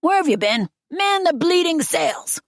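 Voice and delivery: high, loud bellowed order; captain's bellow. A narrator's reading. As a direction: bellow it across the deck, drawing out the command. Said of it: "bellowed at Owen".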